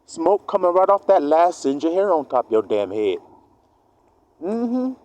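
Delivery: ordinary talk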